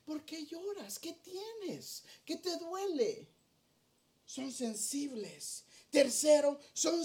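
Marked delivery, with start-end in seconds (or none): 0.0-3.3 put-on higher and much softer, a child's concern; caring child. Preacher voicing a child comforting someone who cries, higher and softer. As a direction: ask gently like a worried little kid. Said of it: "y le dice".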